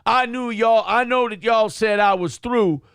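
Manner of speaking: high, loud mock proclamation; imitating the speaker. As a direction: announce yourself like a self-important comeback. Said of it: "He's like".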